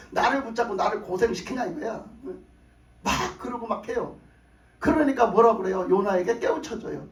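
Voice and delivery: usual speaking voice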